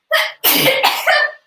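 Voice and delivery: a sudden loud sneeze; sneezing. Real, not acted. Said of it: "I'm gonna sneeze".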